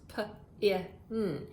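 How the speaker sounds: p, i, n each sound spoken separately. Shown as a demonstration. Teacher sounding out pin for the class, sound by sound. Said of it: "pin has three sounds".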